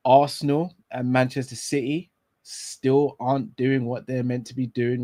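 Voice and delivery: ordinary talk, nothing marked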